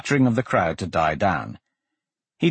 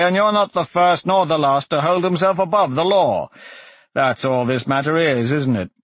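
judge's voice, raised in pitch; pronouncing magistrate; 6 semitones higher, 3 dB louder than usual. Acted. Magistrate rules on the defence before the crowd, stern. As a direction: lecture the prisoner from the bench.

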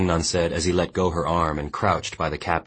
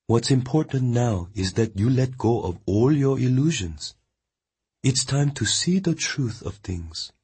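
higher, steady woman's voice; Mongnan consoling; 7 semitones higher than usual. A narrator's reading. Mongnan firmly consoling the weeping boy. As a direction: tell him firmly he died well.

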